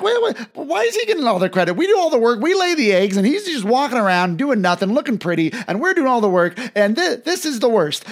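high, loud, fast squawking complaint; indignant hens. Retold or imitated. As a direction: play a huffy hen who feels cheated. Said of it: "these three hens are like".

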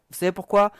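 ordinary talk

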